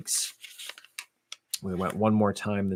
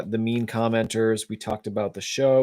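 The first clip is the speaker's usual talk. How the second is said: ordinary delivery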